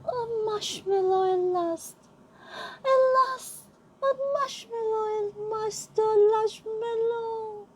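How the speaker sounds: high, slow, quivering with joy; rapturous cry over a treat; overexcited lion squealing at last; nervous and excited. Acted. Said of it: "how nervous and excited he was".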